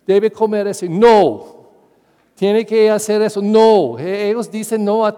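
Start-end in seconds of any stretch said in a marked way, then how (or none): 0.9-1.6 put-on loud, defiant no like a two-year-old; stubborn child shouting no; mock toddler no. Toddler refusing everything. 3.4-4.0 put-on loud, defiant no like a two-year-old; stubborn child shouting no; mock toddler no. Toddler refusing everything.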